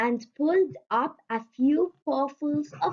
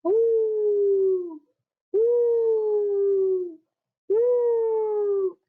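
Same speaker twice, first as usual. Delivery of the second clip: high, rising, louder howls; baby wolf howls; 10 semitones higher, 4 dB louder, much slower than usual. A narrator's reading. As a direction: howl higher each time. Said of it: "shrieked babies".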